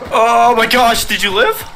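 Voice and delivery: loud, high burst of surprise; surprised exclaim. Real, not acted. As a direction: react in disbelief. Teammate survived a sticky bomb.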